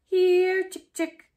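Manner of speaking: high, loud call to the chickens. A narrator's reading. Boy calling chickens, hands round mouth, loud. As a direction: call the hens across the yard. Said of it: "He cupped his hands around his mouth".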